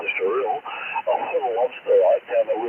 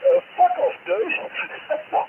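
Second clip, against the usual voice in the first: incredulous outburst; much slower than usual. Retold or imitated. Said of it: "it's like".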